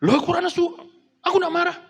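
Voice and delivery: loud, very high, indignant protest; high-toned angry denial. Acted. Spouse insists they are not angry, loud high-pitched protest. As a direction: deny your anger while plainly sounding furious. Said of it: "Tapi nadanya itu tinggi".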